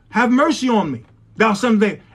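ordinary talk

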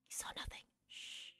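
whispered, secretive denial; conspiratorial whisper. Pretend. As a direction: breathe it like a secret pact. Hiding the drawing from Smokey.

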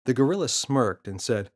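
usual speaking voice

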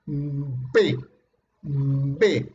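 "B" held back, then released at once; plosive B. Shown as a demonstration. Teacher showing occlusive consonants, plosive B. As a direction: build up air, then pop out "B". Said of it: "Acumulas aire y sale de golpe".

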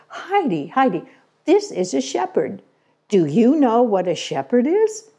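put-on high, eager call to a little girl; an excited mother calling her daughter; calling to Heidi.

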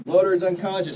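loud barked commands; shouted drill calls. Acted. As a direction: call it out loud over the noise. Casualty drill, calling out commands.

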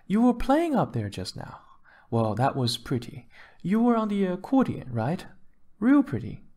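high-pitched, gushing wife; wife paying compliments. A narrator's reading. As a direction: praise the musician in a high female character voice.

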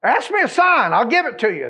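high, loud, commanding call; thundering divine offer; voice of God. Retold or imitated. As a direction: boom it out as the Lord offering a sign. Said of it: "And he asked him".

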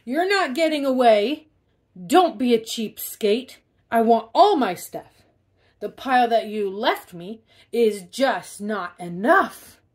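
loud hammed-up greedy pig; greedy demand. Pig clinging to sleigh.

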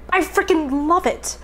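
high, slow, gushing praise; delighted. Real, not acted. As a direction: savor every word. Delighted by the taste.

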